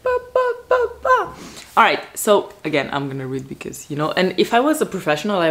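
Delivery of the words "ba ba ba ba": high, sung-out "ba, ba, ba, ba"; playful high staccato noises of suspense; mock-dramatic sting. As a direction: imitate a suspense jingle in a very high voice. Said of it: "I was like".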